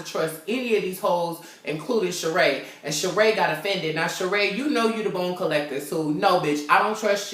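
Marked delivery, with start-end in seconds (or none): none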